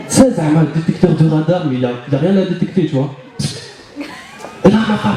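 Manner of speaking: ordinary talk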